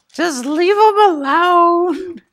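high, drawn-out mock wife voice; imagined wife. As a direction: imitate a wife, slow and very high-pitched. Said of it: "she would be like".

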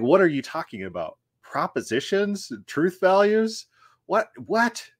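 mock incredulous questions, rising to a high "What?"; baffled disbelief. Ancient Israelites answering a time-travelling theologian, baffled disbelief. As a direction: imitate bewildered listeners, pitch leaping high on each question. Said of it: "look at him like he's insane".

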